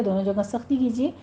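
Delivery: ordinary talk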